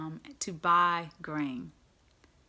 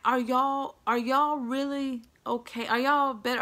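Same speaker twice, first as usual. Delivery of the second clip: louder, higher, pressing challenge; acted confrontation with raised voice; insistent questioning; 5 semitones higher, 6 dB louder than usual. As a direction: demand an answer from the brothers. Joseph testing his brothers, acted.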